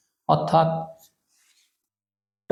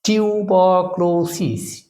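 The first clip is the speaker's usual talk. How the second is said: Tuberculosis slowly, each syllable held; slower than usual. Shown as a demonstration. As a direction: stretch টিউ-বার-ক্লো-সিস evenly. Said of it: "syllable ভাগ করে এটাকে যদি আমরা উচ্চারণ করি".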